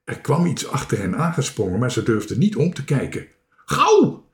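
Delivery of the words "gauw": very high, loud cry; urgent shout. A narrator's reading. Marinka hurries friends back to the boat, urgent shout. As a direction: hurry them along with a yell. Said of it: "riep Marinka".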